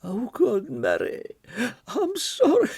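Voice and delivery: distressed lady. Acted. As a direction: cry out an apology, ashamed. Margot apologising after vomiting.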